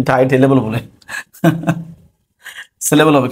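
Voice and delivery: words broken up by real chuckles; laughing. Real, not acted. Teacher laughing after his own slip in class, amused. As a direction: laugh while finishing the term.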